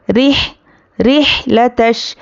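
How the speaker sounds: रिहलतश slow with short i; correct recitation. Shown as a demonstration. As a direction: recite रिह, रिहलतश slowly. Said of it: "रौहा ज़ेर रिह होता है". (सही अदायगी रिहलतश)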